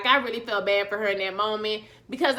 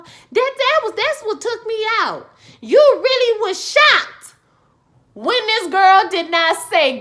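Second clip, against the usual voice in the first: outraged exclaiming; 8 semitones higher, 7 dB louder than usual. Real, not acted. Outraged rant at Molly.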